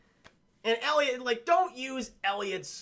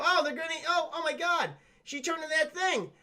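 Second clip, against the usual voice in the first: high-pitched mock horrified wail; hammed-up bad-actor panic, pitched way up; Arnold; 9 semitones higher than usual. Witness watching goblins eat the girl, mock horror. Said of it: "we see Arnold go".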